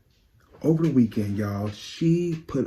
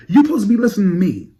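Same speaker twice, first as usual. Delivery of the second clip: fast, much higher and louder scolding; 10 semitones higher, 8 dB louder, much faster than usual. Acted. Fan berating [name] for not listening, fast loud scold. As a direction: rattle it off like a fed-up friend.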